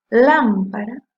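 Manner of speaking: LÁM punched loud in lámpara; stressed first syllable. Shown as a demonstration. Teacher showing the tonic syllable, lámpara with a loud LÁM. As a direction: hit the first syllable of lámpara hard, let the rest drop. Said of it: "que suena mucho más fuerte".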